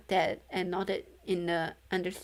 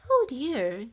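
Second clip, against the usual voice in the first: a higher, louder doting ghost; fawning; 5 semitones higher, 7 dB louder than usual. A narrator's reading. Ghost fawning over Gia, higher and louder. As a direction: coo it fondly. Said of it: "the ghost fawned".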